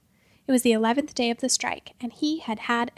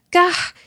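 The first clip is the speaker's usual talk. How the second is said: loud, higher shocked yelp; startled yelp; 6 semitones higher, 9 dB louder than usual. Acted. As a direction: burst out from the cold. Tumbling out of a frozen painting.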